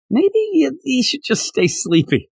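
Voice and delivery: high-pitched musing, easing down; tentative thought aloud. Retold or imitated. Viewer thinks Tanjiro should stay asleep, tentative high musing. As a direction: pitch it up like a wishful suggestion.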